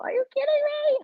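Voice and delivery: high, shrill disbelief; incredulous. Real, not acted. Reacting to news, shrill incredulous squeal. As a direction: squeal it in outrage.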